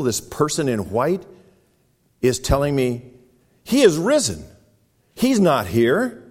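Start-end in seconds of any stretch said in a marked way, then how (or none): 3.6-6.2 high, loud, slowing on He's not here; angel proclaiming. Acted. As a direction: announce it with full force. Angel's words retold to the women.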